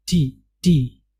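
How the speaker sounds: ordinary talk, nothing marked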